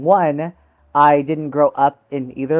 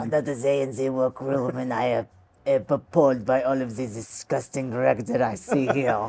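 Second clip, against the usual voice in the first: mock French accent; 7 dB quieter than usual. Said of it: "continues French impression".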